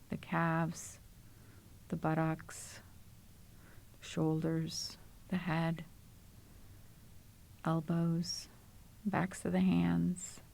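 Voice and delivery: calm, unhurried body-scan voice with long pauses; even, settled meditation delivery, leaving space to breathe; guided meditation. Acted. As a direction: guide them gently into the body, phrase by phrase.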